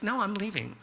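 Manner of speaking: high-pitched retold insistence; insistent girlfriend. Girlfriend retold announcing departure.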